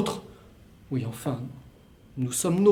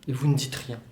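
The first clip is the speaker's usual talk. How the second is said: usual speaking voice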